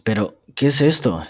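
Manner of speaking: loud, high astonished outcry. A narrator's reading. Catalina astonished at Heathcliff's threat of revenge, loud and high. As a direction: burst out in disbelief. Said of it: "exclamó asombrada".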